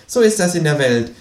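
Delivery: high creature voice with a smile; Känguru, smiling. A narrator's reading. Kangaroo explains who has the money, smiling high voice. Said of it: "sagt das Känguru lächelnd".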